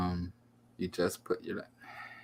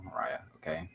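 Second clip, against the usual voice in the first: hushed, unvoiced aside to Mariah; whispered plea; 4 semitones lower, 10 dB quieter than usual. Real, not acted. Streamer whispering to Mariah off-mic, hushed plea. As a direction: whisper it so only she hears.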